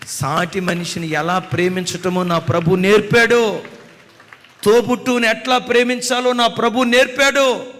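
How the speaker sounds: high, loud preaching cry; fervent preaching. Acted. Preacher proclaiming to the congregation in a loud fervent cry. Preaching climax over music.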